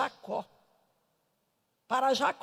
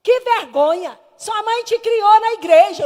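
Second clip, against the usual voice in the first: loud, higher retold rebuke; scolding with gusto; 7 semitones higher, 9 dB louder than usual. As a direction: preach a telling-off at full voice. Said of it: "Aí eu falei com gosto".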